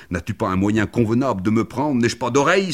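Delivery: loud, high-pitched complaint; indignant outcry. A narrator's reading. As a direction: cry out in objection to being manhandled. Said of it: "s'écria-t-il".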